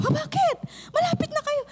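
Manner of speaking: very high, startled principal voice; shocked principal. Retold or imitated. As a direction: gasp it out as the astonished principal. Principal reacts, voiced in story.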